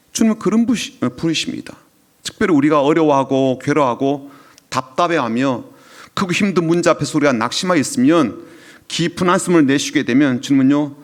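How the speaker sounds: usual speaking voice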